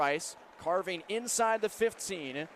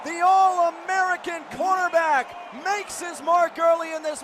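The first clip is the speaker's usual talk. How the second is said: loud, high rising call of the interception; excited play-by-play; 8 semitones higher, 5 dB louder than usual. Acted. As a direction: sell the big defensive play.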